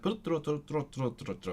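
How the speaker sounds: rolled "Tro, tro, tro" fading away; mimicking native flow. Showing how natives' Welsh flows, quick rolling imitation. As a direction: ripple "tro tro tro" like a native speaker, trailing off. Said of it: "it's so nice when it goes like".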